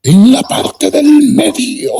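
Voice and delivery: high slow pulpit imitation; imitating the old preacher. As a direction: stretch each word like the fiery village preacher. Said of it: "así hablaba el hombre".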